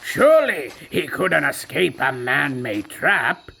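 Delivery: ordinary delivery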